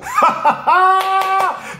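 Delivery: a big burst of laughter, high up. Real, not acted. Reader laughing at a cowardly general, high burst. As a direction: laugh out loud at the absurd scene.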